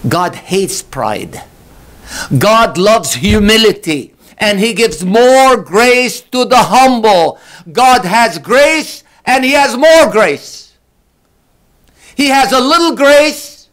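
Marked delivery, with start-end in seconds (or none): 2.4-13.7 high, loud, slow preaching climb; impassioned preaching. Acted. Preacher to congregation, booming and slow. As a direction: build the sermon to a ringing peak. Preacher rising to full force.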